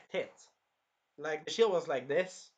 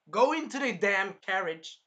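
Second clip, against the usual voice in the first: loud, high, slowed imitated command; urgent mock order; 5 semitones higher, 6 dB louder, slower than usual. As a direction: order it forcefully, word by word. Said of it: "he was pretty much super worried".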